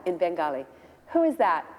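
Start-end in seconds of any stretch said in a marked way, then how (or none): 1.1-1.7 raised, insistent question; calling out. Retold or imitated. Prabhupada calling from his room once more, insistent demand. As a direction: call it out higher, demanding.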